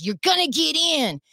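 high-pitched imitation of an insistent cop; forceful order. Cop orders driver into the car, forceful. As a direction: order them in, mimicking the officer.

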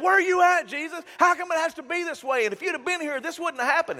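high, loud accusing outcry; mock angry complaint. Lazarus's friends confronting Jesus, loud and upset. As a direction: hurl the grievance at Jesus, hammed-up. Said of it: "getting into a pretty good fight".

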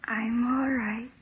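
barely able to speak; quiet dazed murmur; weak and faint. Acted. Waking friend after fainting.

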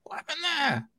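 high, hushed what-happened; mock startled. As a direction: viewer jolted out of the film, mock startled. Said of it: "And you're like".

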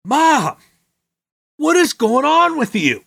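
high, incredulous pleading; hammed-up indignant son; mock exasperated. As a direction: insist you're the hero, baffled. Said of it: "I was immediately like".

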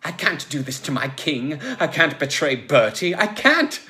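building to a high loud cry; anguished refusal. Acted. Logue cannot betray his king, anguished outcry.

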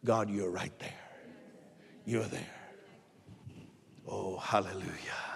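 very low, soft awe; quiet, reverent wonder; hushed reverence. Real, not acted. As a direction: drop almost to a murmur, as if in prayer. Awed prayerful quote of David.

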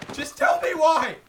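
high loud scream; furious shout. Acted. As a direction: yell it in rage. Attacking her, shouting.

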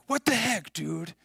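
sudden high, indignant "What the heck"; outraged parent at the roller rink, high and sharp; angry outburst. Retold or imitated. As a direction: bark at the man who knocked your kid down. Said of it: "immediately I'm mad".

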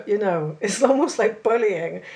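ordinary talk

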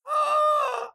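sharp, high gasp of delight; a sudden high intake of breath at something adorable; real squeal-gasp on seeing the picture. Real, not acted. Reacts to the bear picture.

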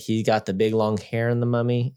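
usual speaking voice

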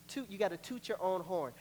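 usual speaking voice

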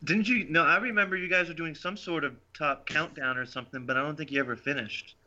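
ordinary talk, nothing marked

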